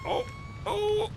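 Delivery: high groans at stretch's peak; groan, relieved, mid-stretch. Real, not acted. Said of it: "I feel a lot better now".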